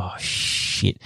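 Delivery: breathless whispered oh shit; whispered curse. Retold or imitated. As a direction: whisper it in fright, no voice. Fleeing from supposed shark.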